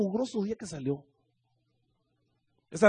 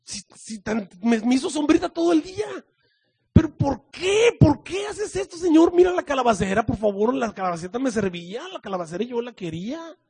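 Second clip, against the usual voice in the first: angry Jonah whining; 7 semitones higher, 4 dB louder than usual. Acted. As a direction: plead and protest like a spoiled child. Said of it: "se sentó más enojado".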